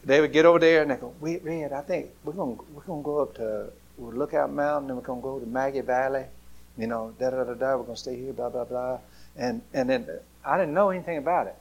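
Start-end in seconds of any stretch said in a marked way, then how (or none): 1.2-9.0 parents murmuring plans softly, quieter; hushed planning. Retold or imitated. Parents planning the trip in secret.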